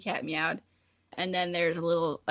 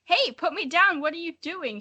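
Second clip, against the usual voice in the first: yelled; 7 semitones higher than usual. Acted. Cat picked up by the dragon yells in protest, high. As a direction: cry out to be put down. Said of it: "she yelled".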